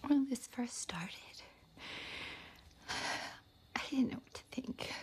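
choked-up, halting love-letter reading; tearful confession. Acted. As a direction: fight back tears while confessing, breath catching between phrases. Confessing affair, fearing for her children.

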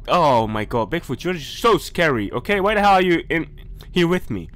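ordinary talk, nothing marked

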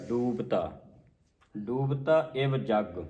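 ਡੂਬਤਾ with a held oo; long u vowel. Shown as a demonstration. Teacher explaining the long mark, drawn-out ਡੂਬਤਾ. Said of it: "ਇੱਥੇ ਲੱਗਿਆ ਦਲੈਂਕੜਾ".

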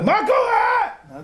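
high, stretched-out yell over music; oblivious high-pitched holler; headphone shout. Acted. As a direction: shout it as if you can't hear yourself. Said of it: "אתה צועק, משוגע".

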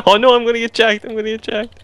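high mock alarm; mock panic. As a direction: panic playfully. Fear of car theft.

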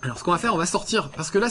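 ordinary delivery

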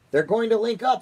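high, loud shout of alarm. A narrator's reading. President shouting that they will link up, loud shout. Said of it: "shouted the president".

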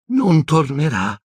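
slow, sorrowful refusal; mournful. A narrator's reading. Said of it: "con aria mesta". (tono mesto e lento)

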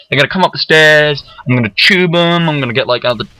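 ordinary talk, nothing marked

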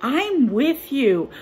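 ordinary talk, nothing marked

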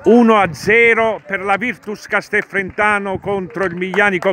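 breathless italian goal commentary. Real, not acted. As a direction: shout the goal with joy. Goal scored, live commentary.

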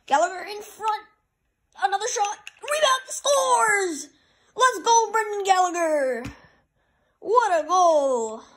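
high, loud play-by-play building to a shout; excited goal call. Real, not acted. Gamer calls Brendan Gallagher's goal, jubilant shouting. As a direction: build to a roar, then stretch out the celebration.

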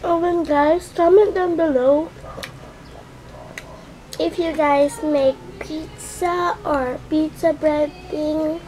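ordinary talk, nothing marked

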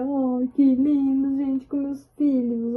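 high, slow gushing over the photo; gushing delight. Real, not acted. Sees family photo.

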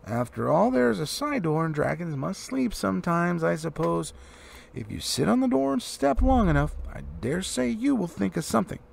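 a flustered little host hurrying everyone off to bed; flustered hobbit. A narrator's reading. Bilbo urges the dwarves to bed, flustered and fussy.

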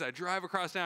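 ordinary talk

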